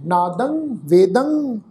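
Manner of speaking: ਨਾਦੰਗ, ਵੇਦੰਗ ending in a nasal ng; ਨਾਦੰਗ, ਵੇਦੰਗ with -ang, not -an. Shown as a demonstration. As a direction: end ਨਾਦੰਗ and ਵੇਦੰਗ on a hummed ng. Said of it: "ਦੱਦੇ ਅੱਖਰ ਦੀ ਨਾਸਕੀ tone".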